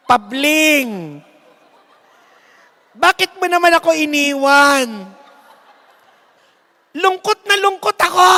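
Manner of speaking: loud wail broken by laughter; a widow wailing for Pabling but laughing; laughing while mourning. Acted. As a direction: cry out grief that keeps turning into laughs. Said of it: "kung umiiyak siya o tumatawa".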